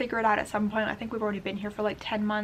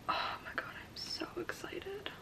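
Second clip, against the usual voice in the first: breathless, slow whispered thrill; excited whisper; 9 semitones higher, 13 dB quieter than usual. Real, not acted. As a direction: whisper it like a secret joy. Whispering excitement over the book.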